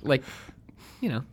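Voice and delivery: ordinary talk, nothing marked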